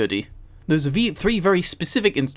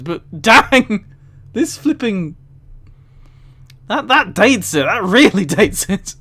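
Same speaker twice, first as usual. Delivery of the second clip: higher and louder, laughing between words; giggly disbelief; 6 semitones higher, 5 dB louder than usual. Real, not acted. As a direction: talk through the giggles. Cracking up at the phrase book.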